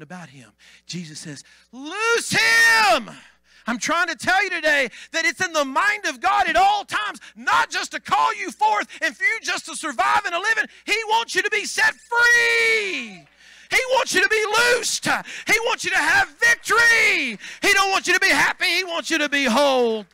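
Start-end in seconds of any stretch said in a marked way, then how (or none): 1.7-3.1 high, loud cry; commanding shout. Acted. Jesus ordering them to free Lazarus, loud shout. 3.7-20.1 high, loud, crying preaching shout. Acted. Pastor telling the church God wants them free, preaching shout.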